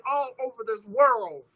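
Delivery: ordinary talk, nothing marked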